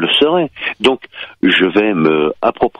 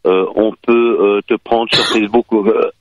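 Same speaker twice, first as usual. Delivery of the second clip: usual speaking voice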